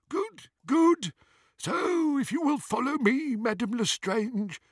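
very high-pitched goblin teller; old goblin. A narrator's reading. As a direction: usher a customer along in a high goblin voice. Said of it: "said the old goblin".

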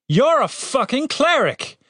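high, slow incredulous outburst; outraged disbelief. A narrator's reading. Captain to the irreligious cleric, outraged. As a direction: hit it high and stretch it in outrage.

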